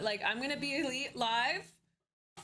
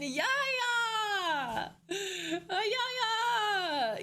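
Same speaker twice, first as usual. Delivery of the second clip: excited yeahs; 8 semitones higher, 3 dB louder, much slower than usual. Real, not acted. Exaggerated high yeahs.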